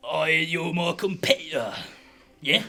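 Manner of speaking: put-on drunken slur; slurring drunk. Drunk Roz sizing up her opponent, slurring. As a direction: slur it like a very drunk old dwarf. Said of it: "She's slurring even more".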